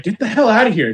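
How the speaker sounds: retold angry order. Manager pushing the homeless man back, mock angry order. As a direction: imitate a manager ordering someone off.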